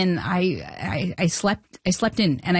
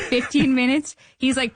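ordinary talk

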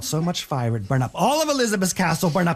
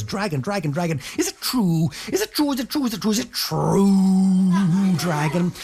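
coaxing princess; 3 semitones higher than usual. Acted. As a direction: flatter the dragon, stretching the question. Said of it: "Elizabeth says".

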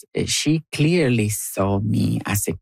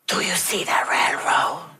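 hushed, urgent stage whisper; 9 semitones higher than usual. Acted. Brother nudging sibling at a railroad crossing, whispering. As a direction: whisper it for an audience to hear. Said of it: "Why are we whispering".

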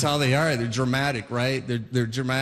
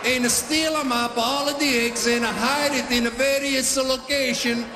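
Italian dad storytelling; 4 semitones higher than usual. Retold or imitated. Dad continues Easter story.

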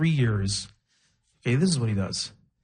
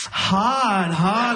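mimicking the coworker, saying hot twice; mock coworker voice; 4 semitones higher than usual. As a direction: parrot the coffee guy. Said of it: "he's like".

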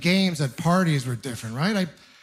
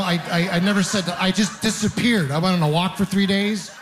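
usual speaking voice

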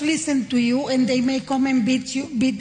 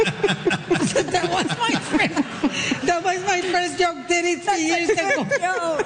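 laughing; 6 semitones higher, slower than usual. Real, not acted. Laughing over his old joke.

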